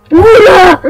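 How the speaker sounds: startled yelp, then nervous laugh-off; scared horror game streamer. Real, not acted. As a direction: jump at the sudden face. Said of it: "il m'a fait peur".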